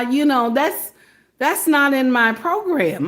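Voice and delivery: ordinary delivery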